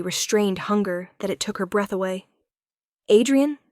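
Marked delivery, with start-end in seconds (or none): 3.1-3.6 high, loud call of his name. Acted. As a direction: call out to stop him. Calling him back at the door.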